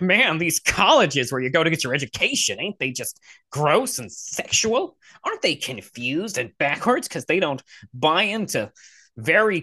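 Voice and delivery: exaggerated parody of a scandalized pundit; mock his argument in a weird, swooping voice. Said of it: "did it in a weird voice".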